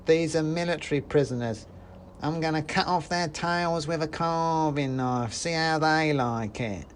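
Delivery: a tiny bully gloating; threatening mouse soldier. Acted.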